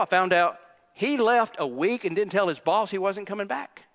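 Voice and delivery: normal talking voice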